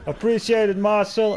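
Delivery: ordinary talk, nothing marked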